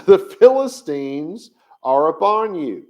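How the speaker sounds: dramatic Delilah quote. Retold or imitated. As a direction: cry out the warning with a laugh in it. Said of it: "Delilah said that to Shimshon".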